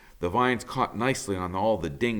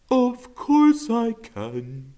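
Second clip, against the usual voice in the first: a suave bird gliding in; musical woodpecker; 6 dB louder, much slower than usual. Acted. Woodpecker's smooth reply.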